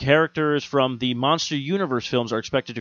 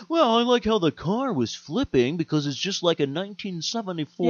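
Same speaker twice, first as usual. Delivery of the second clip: higher-pitched mock film buff; nitpicking viewer; 6 semitones higher than usual. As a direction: imitate a pedantic moviegoer in a raised pitch. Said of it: "for you to sit there and go".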